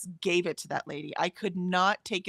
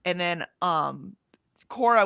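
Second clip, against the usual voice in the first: ordinary talk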